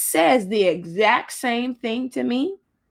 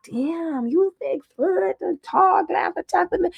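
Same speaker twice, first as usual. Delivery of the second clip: mocking short men; 7 semitones higher than usual. Imitating short men on Plenty of Fish, mocking. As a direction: imitate a short man gawping up, high-pitched.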